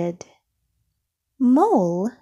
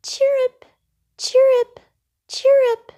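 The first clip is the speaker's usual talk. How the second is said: put-on much higher, tiny chirping baby birds; squeaking chicks; 5 dB louder than usual. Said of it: "squeaked the chicks".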